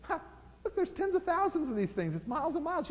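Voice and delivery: high, scoffing doubter; mock critic voice. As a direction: sneer it like a smug critic who knows better. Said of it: "like a good critic, he kinda laughed".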